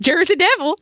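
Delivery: high-pitched, hearty call-out; hammed-up rallying cry. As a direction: put your whole heart into it. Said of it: "With more heart".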